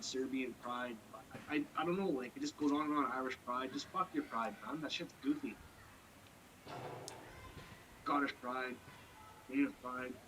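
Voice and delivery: ordinary talk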